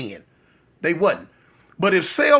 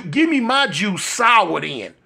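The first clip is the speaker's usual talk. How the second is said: ordinary talk, nothing marked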